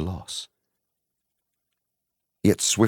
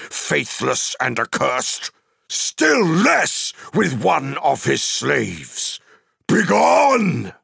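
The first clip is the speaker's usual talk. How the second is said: high, thundering rejection building to a shout; wizard's furious, ringing banishment; defiant cry; 8 semitones higher, 4 dB louder than usual. Acted. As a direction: dismiss the enemy with mounting wrath, climaxing on the last word. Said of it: "he cried".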